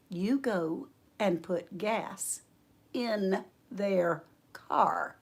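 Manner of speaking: very slow, soft, word by word; deliberate command. Retold or imitated. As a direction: voice the Lord patiently insisting. The Lord repeats order, word by word.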